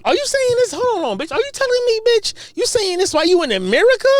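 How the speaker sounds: high-pitched, disbelieving challenge; indignant squawk of questions; incredulous outrage. Real, not acted. Incredulous rant at played clip.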